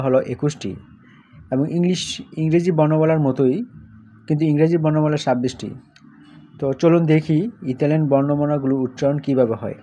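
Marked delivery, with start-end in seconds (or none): none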